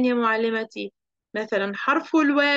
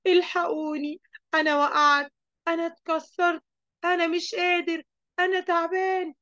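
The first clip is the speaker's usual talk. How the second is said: mock crying out; 6 semitones higher than usual. Storyteller voices injured Tanween Al-Fath screaming for help, mock pained cry. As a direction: act out a hurt child's pleas for help. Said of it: "بقى يصرخ تنوين الفتح يقول".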